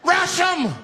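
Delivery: high shouted charge; screamed rallying cry. Acted. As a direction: yell it to rally the bus. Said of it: "Screamed out".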